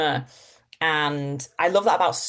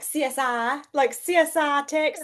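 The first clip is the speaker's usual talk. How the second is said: higher-pitched Texan drawl; Texas accent; 8 semitones higher than usual. Shown as a demonstration. Actress pitching herself for CSI Texas, Texas accent. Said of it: "I could do this, like".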